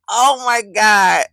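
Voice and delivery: slow "Oh my God" breaking into laughter; stifle a laugh as you drag it out; holding back laughter. Real, not acted. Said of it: "I'm trying not to laugh in her face".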